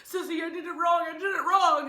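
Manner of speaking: loud, high-pitched panicked little brother; panicking. Retold or imitated. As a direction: imitate a kid freaking out to his sister. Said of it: "he's panicking, he's freaking out".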